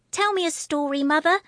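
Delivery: put-on high pleading little girl; crying out child. A narrator's reading. Little girl begging mother for story, high eager cry. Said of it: "cried Constance".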